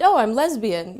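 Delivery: projected with pride. Retold or imitated. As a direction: push the word out boldly. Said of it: "project a little bit more".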